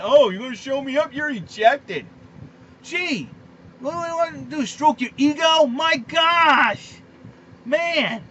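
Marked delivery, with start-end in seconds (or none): 0.0-2.1 louder, quick mocking umpire voice; mocking petty umpire. Ranting host mocks the umpire who ejected Beltre, in a sneering voice. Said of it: "Umpires are so stupid". 5.2-6.9 loud, higher cry of disbelief; exasperated shout. Real, not acted. Host fed up with the umpire shouts in disbelief.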